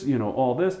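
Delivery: ordinary delivery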